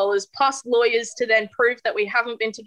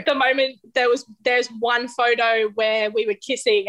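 ordinary talk

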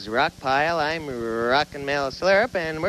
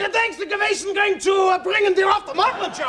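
mock Yiddish shouting; 13 semitones higher than usual. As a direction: yell back like a bickering brother. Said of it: "they start arguing in Yiddish".

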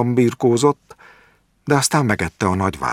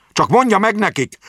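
raised voice; 13 semitones higher than usual. A narrator's reading. Jáki repeating his message for the doctor, voice raised. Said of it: "emelte fel a hangját".

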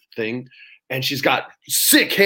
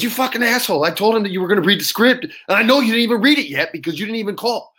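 high-pitched, fast retold rant; imitated scolding, pitched way up; angry friend cursing; 8 semitones higher, faster than usual. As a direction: chew him out in a quick rush. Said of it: "I can curse with you".

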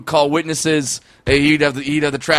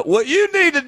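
Doctor Phil impression.

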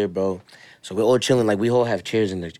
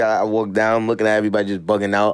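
ordinary talk

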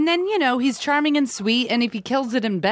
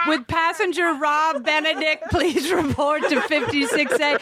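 slow, higher airport announcer voice; flat echoey pa page; 5 semitones higher than usual. Pretend. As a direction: page a passenger over the PA.

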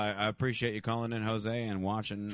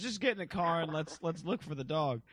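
higher-pitched coaxing act; prank stranger luring; 8 semitones higher than usual. Retold or imitated. Prankster coaxes kid into the car, imitated luring voice. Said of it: "And he goes".

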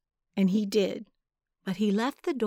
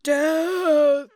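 a huge, high roaring shout; bellowing; 7 semitones higher, 8 dB louder than usual. A narrator's reading. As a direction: bellow it like a war cry.

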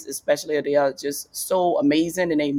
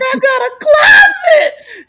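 much higher, louder, slow hammed-up crying; mock sobbing wail; 10 dB louder, slower than usual. Comic telling her dresser why she's crying, hammed-up sob. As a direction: wail it through fake tears. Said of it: "I was like".

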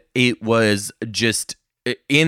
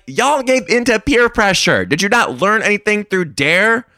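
high, loud scolding of the whole class; shrill-free but loud mock outrage at the kids; indignant rant; 8 semitones higher, 5 dB louder than usual. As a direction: lecture them like a betrayed teacher. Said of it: "I'm over here like".

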